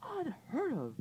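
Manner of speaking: put-on high, prim old aunt protest. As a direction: object in a high fussy aunt voice. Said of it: "protested Aunt Figgy".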